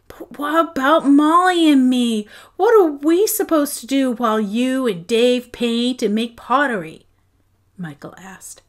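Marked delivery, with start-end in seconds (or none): 0.1-7.1 upset protest. A narrator's reading. Children protest move.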